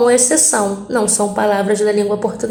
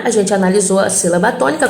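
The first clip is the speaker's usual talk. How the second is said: normal talking voice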